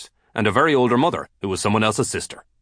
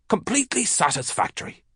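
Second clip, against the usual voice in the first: mock prim voice; 9 semitones higher, 4 dB quieter than usual. Mock formal ending.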